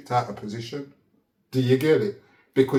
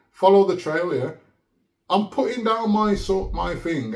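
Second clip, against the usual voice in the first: ordinary talk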